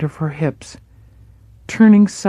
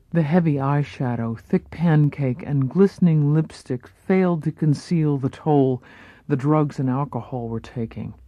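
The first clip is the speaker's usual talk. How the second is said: plain reading voice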